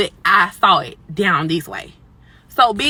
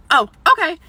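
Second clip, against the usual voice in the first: mock manager voice; 9 semitones higher, 7 dB louder than usual. Manager's reply, high and loud.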